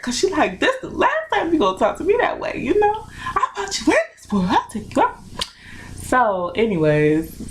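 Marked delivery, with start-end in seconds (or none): 0.6-5.2 high, quick imitation of angry mom; mom going in hard. Mother warning her child about talking back, imitated going in hard. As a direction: do a scolding mother, pitched way up. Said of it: "she was going in hard".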